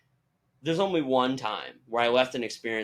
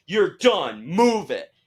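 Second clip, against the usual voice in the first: loud, raised mock command; exaggerated strict order; 6 semitones higher, 7 dB louder, much slower than usual. Staff hurrying fans out, in a mock strict shout. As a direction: order them along, hammed up. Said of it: "I'm exaggerating".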